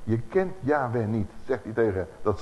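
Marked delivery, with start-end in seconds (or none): none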